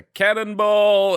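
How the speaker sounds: boulet de canon crié; loud cannonball. Retold or imitated. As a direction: punch it out loud and high. Cat landing on chest.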